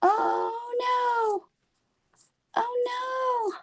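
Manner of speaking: high, wailing oh no; high tearful wail; frightened cry. Acted. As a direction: cry out like a scared child.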